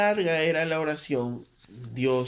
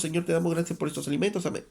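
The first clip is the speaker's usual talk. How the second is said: fast, hurried prayer to get to the food; rushed grace; 3 semitones higher, much faster than usual. Retold or imitated. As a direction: rattle off grace because you're hungry. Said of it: "porque tienen hambre".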